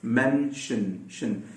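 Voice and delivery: slow soft मेंशन ending in shun; slow model word. Shown as a demonstration. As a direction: say मेंशन, then just शन. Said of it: "शन अगर आपको बोलना है".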